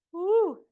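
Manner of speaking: high cheery woo to spur the class on; a bright little whoop; excited woo. Real, not acted. Instructor whoops to cheer the class on, excited woo.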